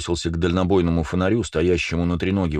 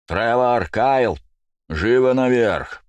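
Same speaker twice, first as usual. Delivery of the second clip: loud call down a speaking tube; through a tube; 5 semitones higher, 4 dB louder, much slower than usual. A narrator's reading. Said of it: "И громко в трубу".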